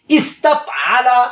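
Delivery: ইস্তাফআলা drawn out slowly, rising at the end; slow model recitation. Shown as a demonstration. Teacher leading students to repeat after him, slow. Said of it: "আমার সাথে পড়তে শিখুন".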